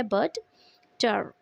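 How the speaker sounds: "but, टर" as two separate beats with a pause between; split into syllables. Shown as a demonstration. Teacher showing the two syllables of butter, split into syllables. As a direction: break "but, टर" cleanly in two. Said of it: "टू syllables use हुए हैं बटर में".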